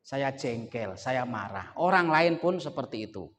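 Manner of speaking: usual speaking voice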